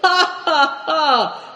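high, loud scornful ha ha ha; mocking laugh. A narrator's reading. Captain scoffing at the fugitives, loud high laugh. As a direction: laugh them off as fools. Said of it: "laughed Kao Cheng".

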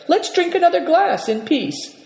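high, lively hospitable urging; crying out. A narrator's reading. Host invites guests to another glass, exclaiming high. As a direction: urge them to stay, pitched well up. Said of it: "cried the Marin".

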